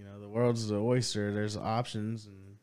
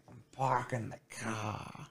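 put-on softer Boston accent, slow, r-less "parking" and "car"; dad's Boston accent; 8 dB quieter, slower than usual. Imitating his dad parking, in a slow, soft Boston accent. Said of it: "My dad used to say that all the time".

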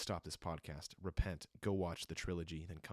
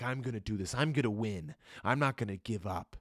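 Macho Man hero; 3 semitones higher, 6 dB louder than usual. Retold or imitated. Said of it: "Like Macho Man".

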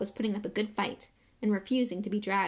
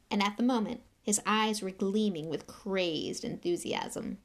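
ordinary delivery

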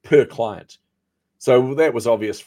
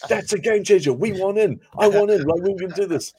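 much higher, eager partner voice; mock excitement; 10 semitones higher, 5 dB louder than usual. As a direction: play the thrilled senior partner who wants in. Partner's sudden enthusiasm retold.

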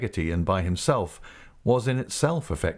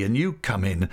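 usual speaking voice

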